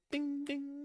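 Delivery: high bell ding sung out; imitated bell. Imitating the ding that snaps Erica awake, high sung bell.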